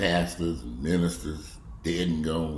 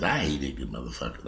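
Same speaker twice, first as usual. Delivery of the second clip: low muttered; 8 semitones lower, 6 dB quieter than usual. Real, not acted. Repeats line, quiet then loud.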